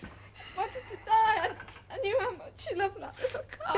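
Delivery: breaking into tears, hushed and sobbing; crying. Acted. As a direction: cry through the apology. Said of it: "don't cry".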